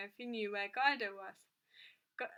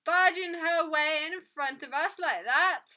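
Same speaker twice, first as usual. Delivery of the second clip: loud, higher voice of an affronted customer; raised, indignant protest; indignant complaint; 6 semitones higher, 11 dB louder than usual. A narrator's reading. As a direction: complain loudly about a queue-jumper. Angry queue-jumping complaint.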